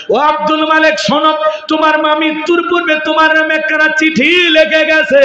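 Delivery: preached call. Acted. Aunt calling out to the grieving son, high preaching call. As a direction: call across to him in a high chant.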